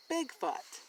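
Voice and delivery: usual speaking voice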